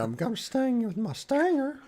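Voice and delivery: high cartoon bee voice; squeaky bee impression. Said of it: "Oh yeah".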